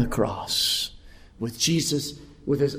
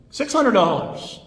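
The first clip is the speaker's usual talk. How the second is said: high, drawn-out bidding call; auctioneer; 7 semitones higher, 5 dB louder, slower than usual. Acted. Auctioneer raising the bid on the lamb, calling out. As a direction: call out the bid across the room. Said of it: "the auctioneer starts things off".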